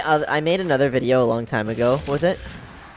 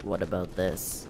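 lower voice; 6 semitones lower, 10 dB quieter, slower than usual. Shown as a demonstration. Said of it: "talk a little lower".

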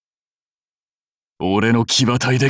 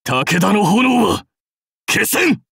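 defiant warrior's roar, pitched up; defiant battle cry; 8 semitones higher than usual. Acted. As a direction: build to a yelled last word.

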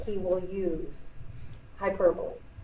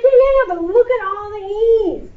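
high, loud mock protest; hammed-up distress, pitched way up; Mister Shelton; 11 semitones higher, 6 dB louder than usual. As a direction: imitate an overdramatic colleague wailing his objection. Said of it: "He's like".

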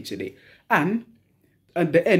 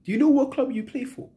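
exasperated rhetorical question; 10 semitones higher than usual. Real, not acted. Repeats the rant, more heated.

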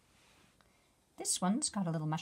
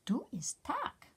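slow breathy bug whisper; little bug whispering a secret; 7 semitones higher, slower than usual. Acted.

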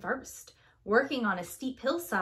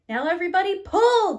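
loud, high rallying call; rally the team to heave together; shout; 8 semitones higher, 10 dB louder than usual. A narrator's reading. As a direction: Kid shouting pull to the trucks. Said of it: "Kid shouts".

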